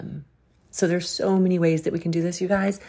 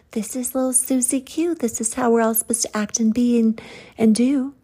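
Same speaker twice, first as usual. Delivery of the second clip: high, loud little-doll voice; mock cutesy conformist; 7 semitones higher, 3 dB louder than usual. Preacher mocking cookie-cutter expectations of women, high cutesy voice. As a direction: mimic a cookie-cutter girl, pitched way up.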